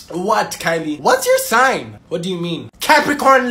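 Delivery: normal talking voice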